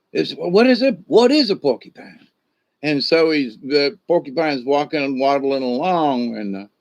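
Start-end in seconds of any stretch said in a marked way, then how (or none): 0.1-2.3 curious mountain lion. Acted. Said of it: "he's like".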